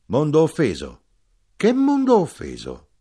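high, loud incredulous question; shouting. A narrator's reading. Wine dwarf challenging talk of offended world, shouting. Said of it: "gridò l'impudico nano del vino".

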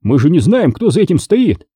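high, insistent protest. A narrator's reading. Billy protests that the enemy is unknown, protesting.

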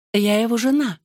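high, sugary sweet voice; sweetest little voice. A narrator's reading. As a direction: say it sweetly, all innocence. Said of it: "самым милым голоском".

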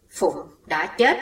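ordinary delivery, nothing marked

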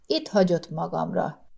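usual speaking voice

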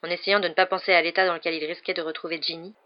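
plain reading voice

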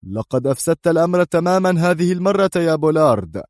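high-pitched, sustained reprimand; stern. A narrator's reading. Boss blames his subordinate for botching everything, sternly. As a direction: scold him with strict authority. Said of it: "قبل أن يقول في صرامة".

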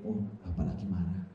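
put-on soft, low child's aside; quietly notice father is angry; a hint of a small child figuring it out; child realising. Child's thought, voiced.

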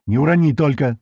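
yelled warning. A narrator's reading. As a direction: call out a quick warning, half-yelled. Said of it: "орёт ей Слива".

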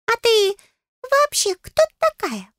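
very high little voice, questioning; high-pitched fairy on guard; wary fairy. A narrator's reading. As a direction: squeak a suspicious question. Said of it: "Насторожился фея".

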